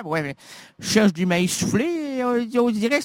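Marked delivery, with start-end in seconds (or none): none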